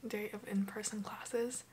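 soft early morning whisper; hushed so nobody wakes. Real, not acted.

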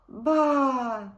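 loud bleating baa; lamb bleat. A narrator's reading. Lost lamb bleating alone as night falls. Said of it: "said the little lamb".

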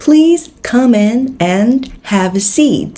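"Please come in and have a seat" spoken very slowly and clearly; slow model sentence. Shown as a demonstration. Teacher reads the example sentence slowly to learners. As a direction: read the example slowly, word by word. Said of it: "Ví dụ".

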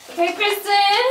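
high, loud self-cheer; pep yourself up happily; excited. Real, not acted. Self-cheer after fire.

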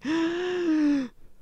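long, high gasp; shocked gasp. Real, not acted. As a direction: gasp at the twist. Mock shock at the story's twist.

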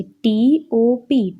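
letters spelt out slowly one by one; slow letter-by-letter spelling. Shown as a demonstration. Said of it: "તેમાં ત્રણ spelling છે".

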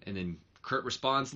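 ordinary delivery

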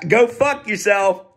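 a loud, laughing, slow go fuck yourself; laughing jab. Retold or imitated. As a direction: toss it off with a laugh. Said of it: "I can say to the A I".

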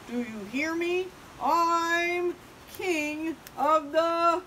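loud, rising, drawn-out brag; boastful proclamation. Acted. Big Bark crowning himself, boasting loudly. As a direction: announce it to all below, stretching it.

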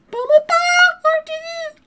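Pummel Party sung in high falsetto. Acted. Falsetto repeat of intro.

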